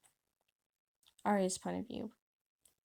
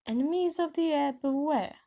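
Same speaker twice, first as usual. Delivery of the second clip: high, loud proclamation; reading out loud; 6 semitones higher, 6 dB louder than usual. A narrator's reading. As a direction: announce it like a threat to everyone. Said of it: "reading it out loud".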